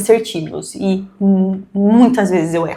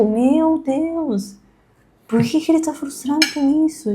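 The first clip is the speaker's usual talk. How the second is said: exasperated inner voice; 6 semitones higher, 4 dB quieter than usual. Retold or imitated. Mother voicing her exasperated inner reaction.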